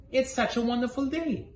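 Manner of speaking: high bright coaxing voice; cheerful coaxing. Acted. Lamore cheers up grumpy Jim, high and bright.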